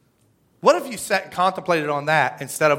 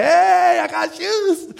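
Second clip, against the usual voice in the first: put-on proud kid; 11 semitones higher, 3 dB louder, much slower than usual.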